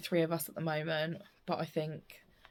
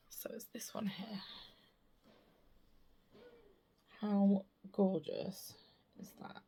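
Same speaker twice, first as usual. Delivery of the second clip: whispering; 7 dB quieter than usual. Real, not acted. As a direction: breathe it out softly. Softly showing the chart.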